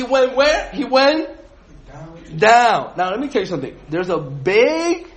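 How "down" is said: "down" said alone, loud and drawn out; stress on down. Shown as a demonstration. Teacher showing the class the key word, "down" hit loud. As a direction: land hard on "down". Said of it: "big emphasis on the word down".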